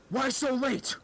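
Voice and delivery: high-pitched mock demand; fed-up teacher. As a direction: imitate a fed-up teacher confronting a latecomer. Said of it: "like, pretty fed up".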